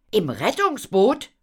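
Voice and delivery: high, loud, rising; astonished question. Acted. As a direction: echo it in amazement. Otto asks in astonishment. (erstaunt, hoch und laut)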